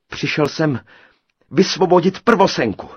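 high, loud, brave declaration; valiant. A narrator's reading. Tailor challenges the giant, valiant and loud. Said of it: "řekl Krejčí srdnatě".

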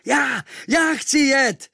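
high, slow, eager shout; boy shouting to join the crew; shouting at full lungs. A narrator's reading. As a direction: yell it to be picked. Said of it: "volal jsem z plných plic".

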